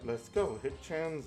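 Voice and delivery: ordinary talk, nothing marked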